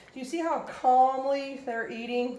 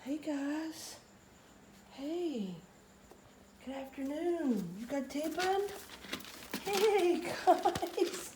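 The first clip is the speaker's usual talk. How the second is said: high-pitched wake-up greeting; puppy talk; 6 semitones higher, 7 dB quieter, slower than usual. Real, not acted. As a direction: coo hello to sleepy puppies, high and gentle. Greeting puppies as they wake.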